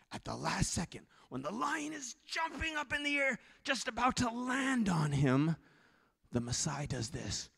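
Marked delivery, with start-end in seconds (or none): 0.1-5.5 high, suspended, tense narration; suspenseful climax. A narrator's reading. Preacher narrates the lion jumping at him, tense. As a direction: stretch out the final second.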